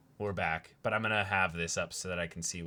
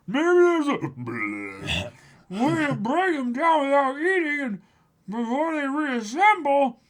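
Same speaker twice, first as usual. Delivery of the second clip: Luffy dub voice; 7 dB louder than usual. Acted. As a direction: play a goofy hungry hero.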